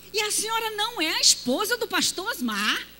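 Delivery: high, rising disbelief; incredulous question. Retold or imitated. Church brother questioning the pastor's wife, high incredulous. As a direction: press her, still amazed. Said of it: "elder insists in disbelief".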